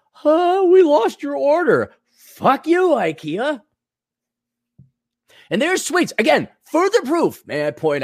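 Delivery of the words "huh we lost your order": mock clueless clerk. Mocking an IKEA clerk.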